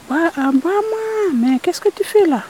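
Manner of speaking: voix de poulpe bien plus haute et étonnée; le poulpe découvre le rat en pleurs, voix haute; poulpe inquiet. Acted. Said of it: "C'est le poulpe qui sort de sa cachette". (le poulpe demande au rat pourquoi il pleure, voix haute)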